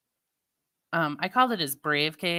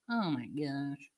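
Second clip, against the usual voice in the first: much softer, lower and slow, a breathed aside; hushed sigh; 5 semitones lower, 10 dB quieter, slower than usual. Real, not acted. Recalling the weather streamer, soft slow hushed aside. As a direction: let it fall away, almost to yourself. Remembering Ryan Hall's streams.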